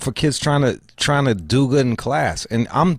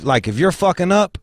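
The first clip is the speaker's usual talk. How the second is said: ordinary talk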